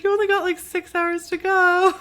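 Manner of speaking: very high, loud, drawn-out cheering on; hammed-up high-pitched consolation; acted encouragement. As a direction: push it up high and stretch the ending. Acted encouragement to fasting guard.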